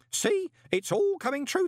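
put-on very high, gleeful old voice; triumphant. A narrator's reading. Groat gloating to Moist, triumphantly. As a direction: crow it in triumph.

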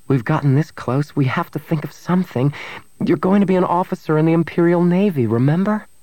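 high, rising, insistent plea; urgent insistence. Acted. Woman urges her lover not to abandon his dream, insistent.

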